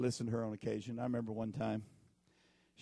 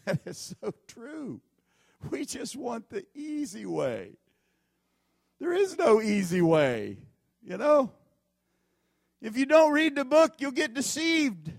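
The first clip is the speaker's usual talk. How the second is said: higher, louder preaching build; preaching at full force; 10 semitones higher, 10 dB louder, slower than usual. Acted. Preacher warning congregation to read the book, loud.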